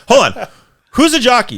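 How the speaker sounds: high, loud baffled questioner; confused Abbott and Costello. Acted. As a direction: play the confused straight man. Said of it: "Abbott and Costello thing".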